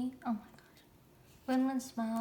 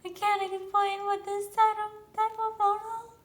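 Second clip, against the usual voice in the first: excited high-pitched; 7 semitones higher, 5 dB louder than usual. Real, not acted. Fangirl overwhelmed by photo.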